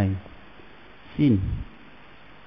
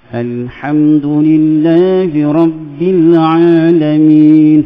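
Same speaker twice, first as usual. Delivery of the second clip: Quran recitation; 5 dB louder than usual. Shown as a demonstration. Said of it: "Semua kena rendah".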